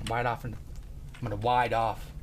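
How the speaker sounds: "ride" softened into "wide"; lisped R, turning "ride" to "wide"; lisping. Pretend. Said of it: "start to have a freaking lisp".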